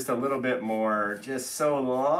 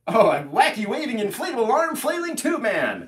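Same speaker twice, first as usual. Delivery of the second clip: excited announcer; 7 semitones higher than usual. Acted. Shouting the tube man name.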